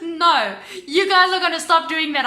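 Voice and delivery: loud, high retold outburst; angry demand. Retold quarrel with phone agent.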